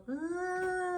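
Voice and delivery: high, drawn-out ooh for the class; a long, high, impressed ooh; ooh of wonder. Shown as a demonstration. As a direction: model an amazed ooh for the kids to copy. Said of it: "This is where you all go".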